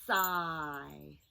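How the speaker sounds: "Sigh" with long hissed s, held vowel; said slowly. Shown as a demonstration. Teacher showing two sounds to children, drawn-out. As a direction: draw out both sounds of "Sigh". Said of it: "Let's say it slowly".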